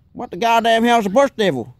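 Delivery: put-on Texan accent, baffled; Texan outburst. Imitating Chuck.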